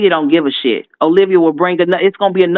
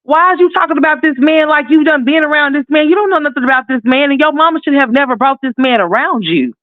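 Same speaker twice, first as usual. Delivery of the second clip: retold outrage; 10 semitones higher than usual. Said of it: "I'm thinking to myself".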